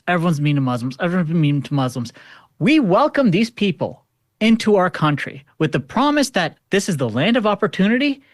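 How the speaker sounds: ordinary delivery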